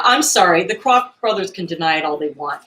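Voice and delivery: normal talking voice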